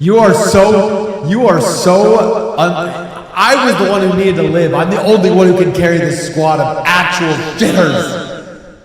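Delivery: loud, laughing outburst; laughing rant. Real, not acted. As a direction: yell it while cracking up. Laughing rant after teammate died.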